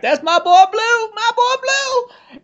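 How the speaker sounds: very high, drawn-out cracking squeal; high-pitched cracking voice. Retold or imitated. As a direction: imitate a guy's squeaky, breaking holler. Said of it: "this really high-pitched voice where it kinda cracks".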